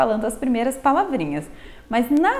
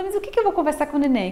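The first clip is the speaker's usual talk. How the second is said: puzzled parent; 4 semitones higher, faster than usual. Retold or imitated. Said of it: "as pessoas ficam na dúvida".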